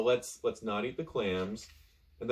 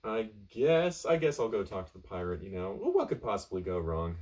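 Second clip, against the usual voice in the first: ordinary delivery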